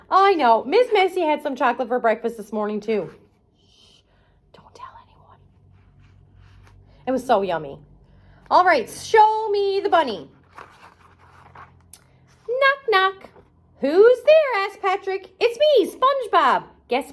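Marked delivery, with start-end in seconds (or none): 3.6-5.4 secret whisper. Pretend. Sharing a chocolate secret with kids. 12.5-16.6 high, bright cartoon knock-knock exchange; cartoon voices. Reading a SpongeBob book to children, high playful voices. Voices story characters at door.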